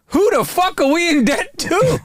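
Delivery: high, drawn-out comic incredulity; slow, high-pitched punchline in disbelief; Hannibal Buress. Retold or imitated. Retelling a comedian's debt joke, high and incredulous. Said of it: "Hannibal Buress had a great joke".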